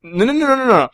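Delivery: high, rattled-off string of denials; hurried protest. Real, not acted. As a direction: wave the objection away at high pitch. Cutting off the co-host's objection.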